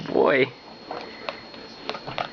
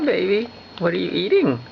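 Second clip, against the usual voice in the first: normal talking voice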